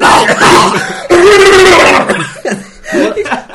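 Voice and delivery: loud high howl of laughter, near tears; helpless shrieking laugh. Real, not acted. Laughing about the terrible Scottish accent in the video, loud shrieking laugh. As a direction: crack up completely at the bad accent.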